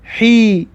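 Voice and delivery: حی said with a breathy h from the throat and an ee; teacher models حی as a throaty hee; kasra sound hi. Shown as a demonstration. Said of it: "ح را ببینید کسره که دارد".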